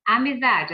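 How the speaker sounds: "Amizade" with a loud stressed "za". Shown as a demonstration. Teacher showing the strongest syllable, loud stress on "za" in "Amizade". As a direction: punch the "za" in "Amizade". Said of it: "a sílaba mais forte".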